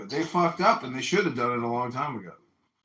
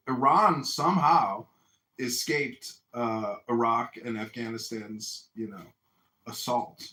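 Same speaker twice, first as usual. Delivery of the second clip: usual speaking voice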